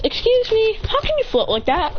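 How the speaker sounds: high-pitched polite question; curious girl character. Acted. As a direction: ask in a lifted, amazed voice. Character asks floater in amazement.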